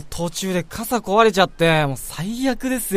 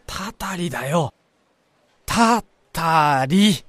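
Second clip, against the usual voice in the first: ominous; much slower than usual. Acted. Spooky hint about a curse.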